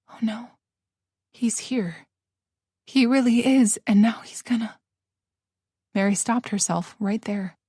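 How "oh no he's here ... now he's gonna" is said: hushed, breathless fear; terrified whisper. Acted. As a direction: whisper it as he pounds.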